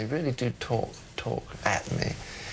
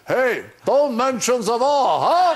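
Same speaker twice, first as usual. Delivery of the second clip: loud, slow, higher mock German shout; jolly shout; 8 semitones higher, 6 dB louder, slower than usual. Jolly German businessman shouts at John across the lobby, loud and laughing. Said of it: "and he shouts".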